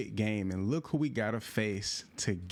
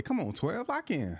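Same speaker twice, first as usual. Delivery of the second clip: pleading higher, come on twelve; urging on; 8 semitones higher than usual. Real, not acted. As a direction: urge your teammate to lock in. Urging a teammate during play.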